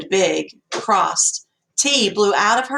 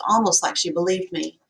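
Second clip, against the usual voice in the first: ordinary delivery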